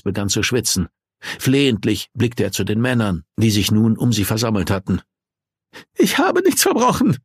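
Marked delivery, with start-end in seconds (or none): 6.0-7.2 high, frightened protest; trembling voice. Acted. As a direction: insist shakily on innocence. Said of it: "beteuerte er mit zitternder Stimme".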